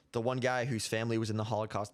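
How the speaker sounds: normal talking voice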